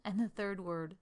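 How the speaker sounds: normal talking voice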